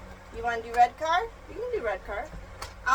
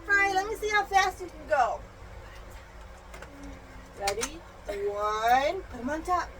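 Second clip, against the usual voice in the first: ordinary delivery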